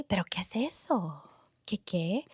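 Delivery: soft, breathy confused grandma asking what that is; grandma's puzzled hush. Retold or imitated. Grandma asks granddaughter about bacon, hushed confused whisper.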